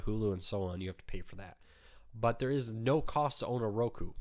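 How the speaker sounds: normal talking voice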